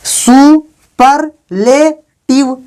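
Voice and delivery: सुपरलेटिव broken very slowly into सु-पर-ले-टिव; teacher slowly splitting सुपरलेटिव into syllables; syllable-by-syllable, silent E. Shown as a demonstration. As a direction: sound out सुपरलेटिव in four long beats, ending on a closed v. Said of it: "अब यहाँ पर भी ई silent है".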